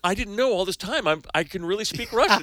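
high, surprised questioning, retold; retelling her amazed question to the girls; surprised own younger self. Retelling her surprise at the Russian teens, lightly mock-amazed. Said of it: "I said".

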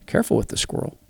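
hushed nervous whisper; a worried father's whisper; whispered warning. Retold or imitated. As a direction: whisper it, afraid to startle it.

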